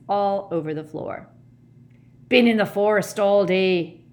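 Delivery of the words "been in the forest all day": loud, somewhat breathless character voice; panting. A narrator's reading. Hagrid arrives striding from the forest, panting loudly. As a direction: puff it out loudly after hurrying in. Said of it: "he panted".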